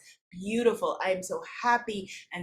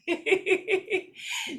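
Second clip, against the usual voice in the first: high laugh; 11 semitones higher than usual. Real, not acted. Laughs after "hold on.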